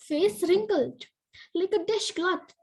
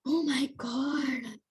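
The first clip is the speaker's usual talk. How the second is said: exasperated groan; 4 semitones lower, 5 dB quieter, much slower than usual. Acted. Elf frustrated, slow groan.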